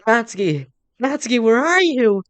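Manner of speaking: high, loud searching call. Acted. Main character searching for upset Natsuki, calling out. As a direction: call out for someone out of sight, stretching the words. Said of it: "Call out for her".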